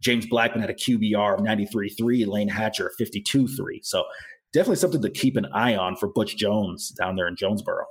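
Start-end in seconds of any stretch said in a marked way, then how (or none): none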